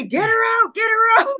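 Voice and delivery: high, urgent calling out; urgent order. Retold or imitated. Staff calling security to remove the nurse, high urgent shout. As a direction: call it out high, hurried.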